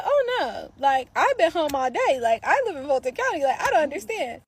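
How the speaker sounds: high, loud protest of innocence; feigned innocence. Acted. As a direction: plead ignorance in a high, insistent voice.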